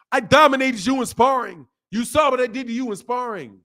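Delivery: high-pitched mock bragging; mock boasting. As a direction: imitate a loud boast, pitched well up. Acts out boastful sparring fans.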